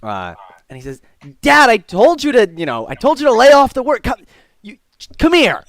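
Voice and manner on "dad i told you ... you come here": high, loud scolding; exasperated son. Acted. Son telling Dad to stop working, exasperated call. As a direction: nag Dad loudly across the room.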